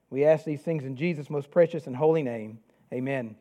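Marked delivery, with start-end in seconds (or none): none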